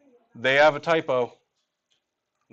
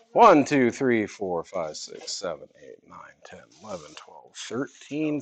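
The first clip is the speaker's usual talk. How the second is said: counting, fading to a whisper; 6 semitones lower, 5 dB quieter than usual. Real, not acted. Counting problems while fading.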